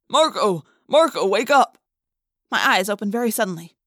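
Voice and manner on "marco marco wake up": urgent calling. Acted. Father waking son from nightmare.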